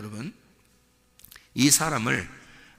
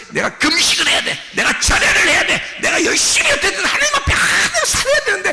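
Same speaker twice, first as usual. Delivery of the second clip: acted frantic striving; 6 dB louder than usual. Religious believer listing what they must do, hammed-up frantic striving. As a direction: pitch climbing steeply with each duty.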